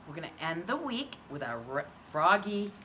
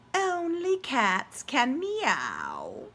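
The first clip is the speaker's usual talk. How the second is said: cat voice; 6 semitones higher, 4 dB louder, much slower than usual. Acted. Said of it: "said the cat".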